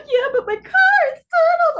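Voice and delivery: loud, very high mock bawling; imitated hysterics. Imitating mom upset over the car, hysterical. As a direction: wail it, overdoing the tears. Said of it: "really hysterical on the phone".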